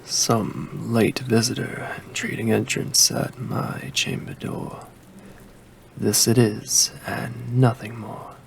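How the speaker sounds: plain reading voice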